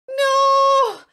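very high, loud terrified "No!"; witch's scream. A narrator's reading. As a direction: scream it in terror. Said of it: "cried the witch".

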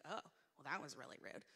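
low, soft offended mutter; hurt private thought. Retold or imitated. Woman taking a remark the wrong way, hurt private thought. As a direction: think it aloud under your breath.

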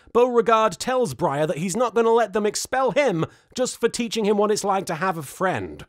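ordinary talk, nothing marked

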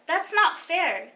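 slow, loud, high complaint; pouting. A narrator's reading. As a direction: protest like a sulky kid. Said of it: "Jenny pouted".

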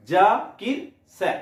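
জা, কির, স্যার with gaps; three-part slow demonstration of জাকির স্যার; syllable-by-syllable. Shown as a demonstration. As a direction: say জাকির স্যার slowly in three separate beats. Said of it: "স্যারটাকেও করে নাও".

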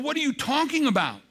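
high, fast, disbelieving; king startled by the queen's words; stunned question. Retold or imitated. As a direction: blurt it out in shock. King stunned by Esther's plea.

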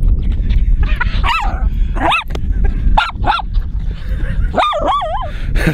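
long squealing laugh; high laugh. Real, not acted. Owner laughing with the dogs at the top, high giggle.

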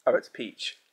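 normal talking voice

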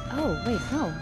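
ordinary talk

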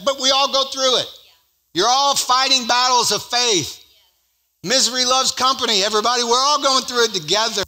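loud, high-pitched rallying preacher; full-force preaching. Real, not acted. Preacher rallying a congregation through shared trials, full-force preaching. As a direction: lift the voice and preach it out to everyone.

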